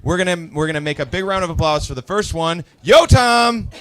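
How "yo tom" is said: loud, high call bringing on the comic; stage introduction. Real, not acted. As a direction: shout the name to welcome the next act. Host announces next comic.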